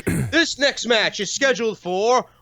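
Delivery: slow, high ring announcer boom. Retold or imitated. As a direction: announce it to the arena. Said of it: "where the announcer says".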